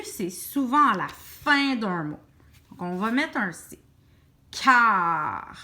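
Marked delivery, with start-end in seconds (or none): 4.5-5.6 CAR drawn out long, falling lower; stretched syllable. Shown as a demonstration. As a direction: hold each sound of CAR so A and R are heard. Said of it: "j'entends le A".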